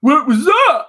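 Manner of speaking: a loud, high puzzled call; mock Titus O'Neil. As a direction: ham up a baffled wrestler yelling. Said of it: "towards the end going".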